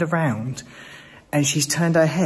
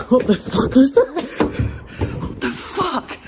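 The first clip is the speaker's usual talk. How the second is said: frightened shout; 11 semitones higher than usual. Real, not acted. Startled by a noise near the exit, shouting in fright. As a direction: yelp it out, spooked.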